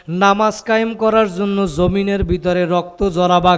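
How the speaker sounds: ordinary talk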